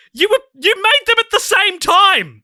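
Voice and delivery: real frustrated outburst; exasperated shout. Real, not acted. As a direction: blow up at the filmmakers' obvious mistake. Exasperated rant about the sequels.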